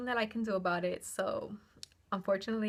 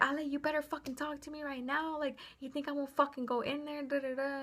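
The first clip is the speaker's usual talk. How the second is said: mock yell; 6 semitones higher than usual. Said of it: "He was screaming my name".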